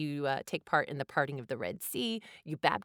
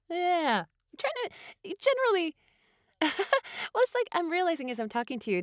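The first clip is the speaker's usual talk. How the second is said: laughing; 14 semitones higher, 3 dB louder than usual. Real, not acted. Laughing after host's joke.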